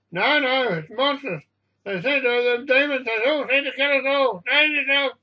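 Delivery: high-pitched alarmed cries; panicked raving. A narrator's reading. Passenger warning others of demons aboard, panicked raving. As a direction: warn everyone of demons in panic.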